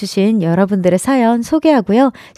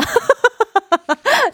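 embarrassed high giggle; 13 semitones higher than usual. Real, not acted. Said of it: "우선 죄송합니다".

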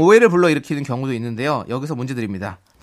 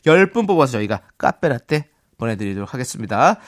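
ordinary delivery

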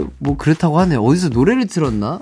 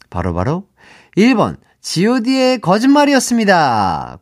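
game show host; 5 semitones higher, 4 dB louder than usual. Acted. Dramatic answer reveal.

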